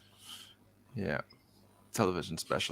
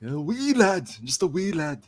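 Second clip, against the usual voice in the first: high-pitched put-on Scottish; Scottish accent; 8 semitones higher than usual. Shown as a demonstration. Showing off accents to co-hosts, high Scottish accent. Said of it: "Definitely more Scottish there".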